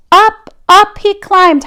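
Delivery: plain reading voice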